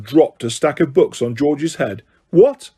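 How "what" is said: high, loud shocked What; surprised shout. Acted. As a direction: blurt it out in shock. Twins shocked by news.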